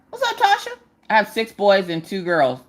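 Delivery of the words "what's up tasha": high, bright shout-out to Tasha; excited greeting. Real, not acted. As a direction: greet the viewer with a jump up in pitch. Greeting a viewer.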